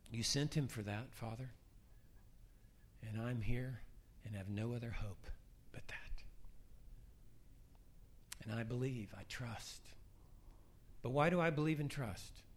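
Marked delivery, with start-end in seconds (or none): 0.1-9.8 soft, halting prayer, dropping at phrase ends; humble prayer. Acted. Believer addressing the Father with no other hope, quiet humble prayer. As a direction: speak quietly to the Father, pausing often.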